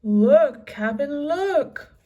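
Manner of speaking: loud, high thrilled call; excited shout. A narrator's reading. Pinky showing the medallion, loud excited call. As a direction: wave the captain over. Crewman shouting about treasure.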